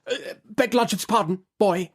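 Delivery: high, quick lawyer's interruption; hasty correction. A narrator's reading. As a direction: cut in too soon. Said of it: "says Mister Tangle, prematurely".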